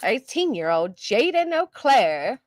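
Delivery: slow, exaggerated mock reporter delivery; news reporter voice. Streamer parroting the reporter on the news clip, mock reporter voice. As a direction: stretch it out like a TV newscaster. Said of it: "like, reporter voice".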